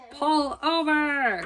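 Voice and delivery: loud, slow, drawn-out shout; bullhorn shout. A narrator's reading. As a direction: bellow it through a bullhorn. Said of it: "He shouted into his bullhorn".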